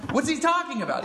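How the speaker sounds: high-pitched question through laughter; laughing bafflement. Acted. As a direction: ask it baffled, breaking into a laugh. Mock bafflement at cryptic sayings.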